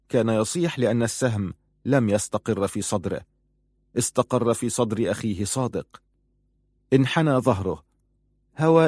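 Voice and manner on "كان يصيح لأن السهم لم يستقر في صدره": ordinary delivery, nothing marked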